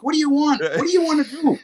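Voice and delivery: high, coaxing voice for a dog; talking to a dog. Retold or imitated. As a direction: ask it like talking to a pet.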